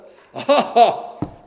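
loud boastful laugh; brash laugh before the boast; cocky laugh. Acted. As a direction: laugh big like a man sure of himself. Mocking Peter's self-confidence.

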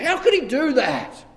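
loud, high outraged cry; David's loud indignant outburst; angry. Acted. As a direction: rage at the rich man. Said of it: "And he's angry".

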